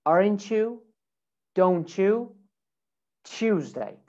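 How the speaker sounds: CH in "Aren't you", "Don't you" and "Tuesday"; blended CH sound. Shown as a demonstration. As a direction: say "aren-choo, don-choo, choozday". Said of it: "make that a che sound".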